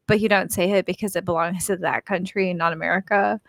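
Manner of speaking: ordinary talk, nothing marked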